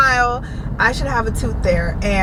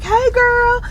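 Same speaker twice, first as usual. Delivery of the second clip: bright, much higher friendly hello; cheery greeting; 14 semitones higher than usual. Retold or imitated. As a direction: greet a friend with a high chirp. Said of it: "I'm like".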